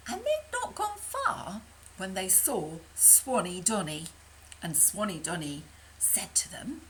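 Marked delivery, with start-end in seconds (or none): none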